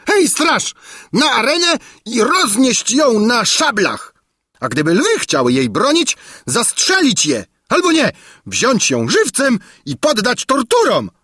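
high, raging royal commands; yelled orders. A narrator's reading. King Padella ordering the woman's death, high yelling. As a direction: yell orders at the guard in a rage. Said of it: "wrzasnął Padella".

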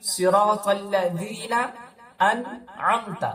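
আনআমতা with a still নুন; slow recitation. Shown as a demonstration. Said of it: "আনআমতা এখানে পড়বেন না". (নুন সাকিন)

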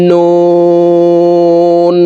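"নুন" with round lips and a very long oo on a high level tone; four-alif stretch. Shown as a demonstration. Teacher demonstrating noon, long round-lipped hold. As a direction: draw out "নুন" as one steady chanted note. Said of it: "ঠোঁট গোল করে উচ্চারণ করতে হবে".

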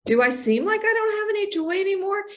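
a hint of a concerned friend asking gently; friend's question. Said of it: "a true friend that says".

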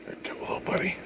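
soft whisper to a pet. Real, not acted. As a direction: whisper it to the cat. Whispering to the cat.

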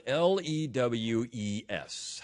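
slow, letter-by-letter spelling. Shown as a demonstration. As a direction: spell it out slowly, each letter separate. Spelling a word for listeners.

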